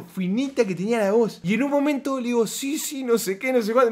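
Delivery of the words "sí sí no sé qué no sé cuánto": imitating high girl's voice. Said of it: "no lo puedo ni imitar".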